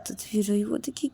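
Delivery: plain reading voice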